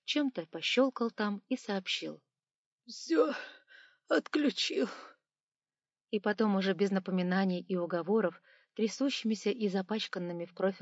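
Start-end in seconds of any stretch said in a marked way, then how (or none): 2.9-4.9 high, broken whine; tearful high-pitched whimper. A narrator's reading. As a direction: report it while moaning in pain. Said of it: "он, подвывая".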